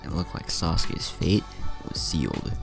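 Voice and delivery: usual speaking voice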